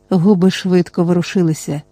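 usual speaking voice

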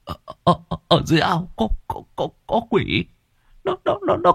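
high, stuttering in fright; terrified stammer. Acted. Boy points at the pond stammering in terror to the villagers. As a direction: stumble over every word, shaking with fear. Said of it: "Thằng Ngưu lắp bắp".

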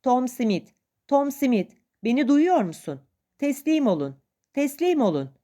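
acted urgent hailing; calling out. A narrator's reading. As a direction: shout across to your mates, urging them. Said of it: "Hemen bağırmaya başladı".

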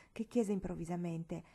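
usual speaking voice

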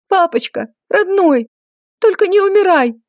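high, desperate pleading. Acted. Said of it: "просила я".

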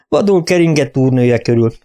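usual speaking voice

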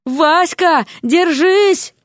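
high, urgent shout of encouragement; urgent call. Acted. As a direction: call out to a friend in danger. Calling to friend in danger.

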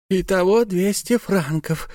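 high, slow grumbling aside; miser's aside. A narrator's reading. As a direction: count the cost in dismay. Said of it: "прошептал ростовщик".

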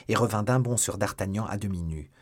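ordinary delivery

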